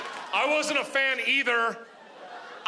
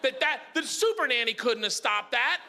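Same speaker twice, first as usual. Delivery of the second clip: high-pitched indignant self-defence; exasperated comic rant; 7 semitones higher than usual. Acted. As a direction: protest at a high pitch, hammed-up. Defends himself against audience judgment.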